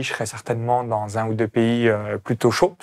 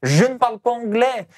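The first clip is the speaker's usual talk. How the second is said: voiced objection; 8 semitones higher than usual. Host voicing would-be expatriates' first objection, high and emphatic. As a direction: put the common objection emphatically, pitched up. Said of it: "généralement, euh, deux objections".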